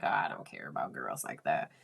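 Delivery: low, soft, quick brush-off; throwaway low mumble of indifference; nonchalant. Retold or imitated. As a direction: toss it off like an unbothered teen. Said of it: "like, really nonchalant".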